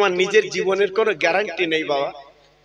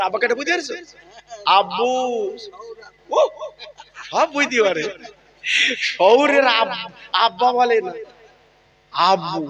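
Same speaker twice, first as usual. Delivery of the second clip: ordinary talk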